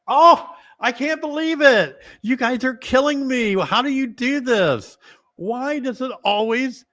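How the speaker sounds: exasperated. Real, not acted. Mock despair at the wheel.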